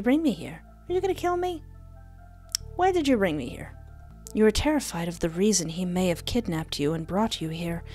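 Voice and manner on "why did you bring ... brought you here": ordinary talk, nothing marked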